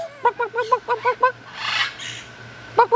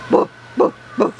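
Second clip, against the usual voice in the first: doggy woof. Shown as a demonstration. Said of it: "what does the doggy say?".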